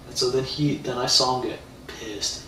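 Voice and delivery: usual speaking voice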